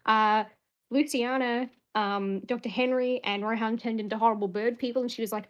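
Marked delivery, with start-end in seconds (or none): none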